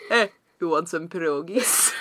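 laughing, put-on vague Eastern European villain; mock villain accent. Co-host offering poisoned pierogies, mock villain accent. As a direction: ham up a sinister foreign accent through giggles. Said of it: "I don't know what the accent is".

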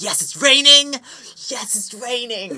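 high, loud joyful cheer; gleeful about rain. Retold or imitated. Friend cheering happily about the rainy weather. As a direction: cheer like rain is the best news ever. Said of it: "I'm like".

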